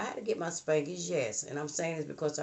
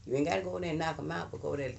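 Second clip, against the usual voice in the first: ordinary talk, nothing marked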